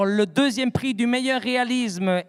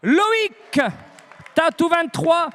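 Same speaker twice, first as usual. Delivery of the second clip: excited shout; 5 semitones higher, slower than usual. Real, not acted. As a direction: call the winners out with a big shout. Announcing the first prize winners.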